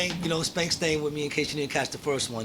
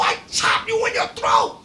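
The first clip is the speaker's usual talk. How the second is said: loud, high fake Chinese accent threat; bad Chinese accent; 4 dB louder than usual. Imitating the prank caller threatening Watts, bad Chinese accent. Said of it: "in the worst Chinese accent you ever heard".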